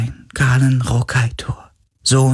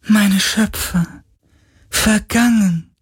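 tormented echoing moans; 6 semitones higher than usual. Acted. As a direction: mourn them like an echoing spirit. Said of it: "klagte der Geist". (klagende Geisterstimme)

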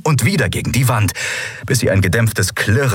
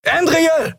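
very high-pitched yell; frantic high shout; bellowed name. A narrator's reading. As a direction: scream out a warning to a friend. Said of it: "hörte sie Kai brüllen".

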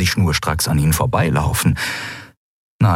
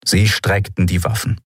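ordinary delivery

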